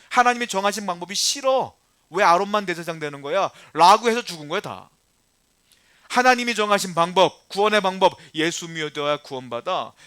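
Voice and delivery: loud, driving sermon voice; fiery preaching. Acted. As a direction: press the point home to the congregation. Preacher builds to full force. (힘있게 외치는 설교)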